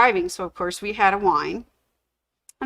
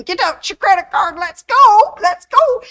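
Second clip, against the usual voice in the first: high, loud, rushed friend's voice, rising higher; excited urging; 11 semitones higher, 8 dB louder than usual. Retold or imitated. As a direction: squeal at her to hurry up and pay. Betty urging her to pay.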